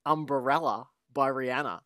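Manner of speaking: ordinary talk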